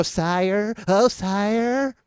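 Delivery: fawning servant's cry, sire drawn out; bow and scrape like a groveling servant; Howard Morris impression. Comedian doing Howard Morris as a fawning servant. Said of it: "Howard Morris to come in and go".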